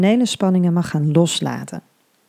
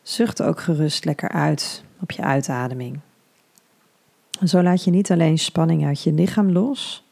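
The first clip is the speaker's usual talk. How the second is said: soothing hypnobirthing guide; guided meditation. Acted.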